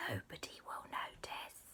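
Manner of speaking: very soft whisper; conspiratorial whisper. Acted. Auntie plots smuggling the dog in, whispering. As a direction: whisper a sneaky plan.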